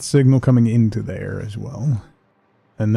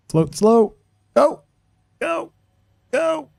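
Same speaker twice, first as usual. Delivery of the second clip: high-pitched urging; excited cheering; much slower than usual. Real, not acted. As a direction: squeal it on. Cheering a jump in game.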